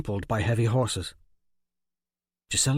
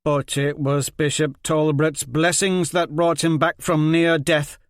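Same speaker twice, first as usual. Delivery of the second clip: loud fervent insistence; 6 semitones higher, 6 dB louder than usual. Acted. Liz Hen argues faith.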